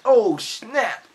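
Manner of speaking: crowd's hammed-up wow; amazed cry. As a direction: blurt out the amazement. Everybody reacts to record.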